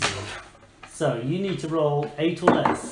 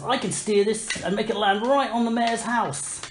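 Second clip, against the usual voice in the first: higher-pitched boast of a confident adventurer; Greenleaf; 6 semitones higher than usual. Acted. Game master voices a player's hero announcing a landing, bold and higher. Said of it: "Greenleaf says".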